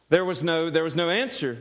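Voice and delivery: ordinary talk, nothing marked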